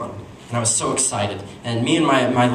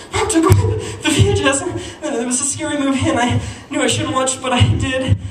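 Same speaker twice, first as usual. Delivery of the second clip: high-pitched mock blubbering; hammed-up tearful whine of a boy confessing; crying; 13 semitones higher, 3 dB louder than usual. Crying son seeking dad's support, high mock sobbing. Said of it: "And I'm crying".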